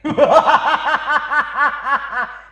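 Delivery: loud demonic Ravana laugh; villain's booming laugh. Acted. Ravana laughing hugely at the reporter's question. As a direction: roar with arrogant laughter. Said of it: "ఇంత పెద్దగా నవ్వుతున్నావు".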